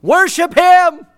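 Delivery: high, loud ringing cry; a preacher voicing David's loud cry of worship. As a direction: call it out with all your voice. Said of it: "he just cries out".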